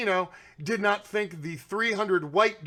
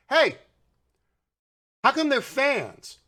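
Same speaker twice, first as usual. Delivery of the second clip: mock call-out; 6 semitones higher, 3 dB louder than usual. Calling out to right-wing media.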